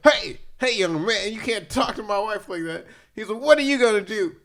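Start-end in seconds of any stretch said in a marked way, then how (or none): 0.1-2.9 raised, higher mock scolding; mock-stern challenge, pitched up; confronting husband. Acts out husband confronting friend. 3.4-4.3 loud, high retold comeback; raised voice, playacting a confrontation; mock defiant challenge. Acts out friend's defiant challenge.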